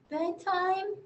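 usual speaking voice

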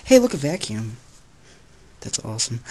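normal talking voice

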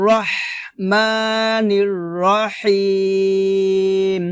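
slow, drawn-out tajweed recitation; measured chanting for students to repeat; Quran recitation demo. Shown as a demonstration. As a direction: recite slowly, holding the long vowels. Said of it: "আবার".

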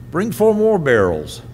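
mock calling to the helpers; retold called-out order. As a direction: call out the order as Elijah, lightly acted. Elijah's order retold as a call.

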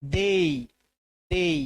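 দেই with a full ei glide, not দে; correct dei. Shown as a demonstration. Teacher models the right English vowel for students, with a clear glide. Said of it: "কিন্তু আমরা বলি কি এ বলি".

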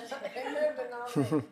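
short embarrassed laugh; light laugh. Real, not acted. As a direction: laugh it off, a bit sheepish. Chair laughs at needing nomination.

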